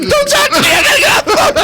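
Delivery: very high, shouted through laughter and tears; frantic hammed-up yell; laughing crying shout. Son yelling at mom on the phone in panic. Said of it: "I'm like".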